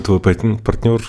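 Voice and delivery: ordinary talk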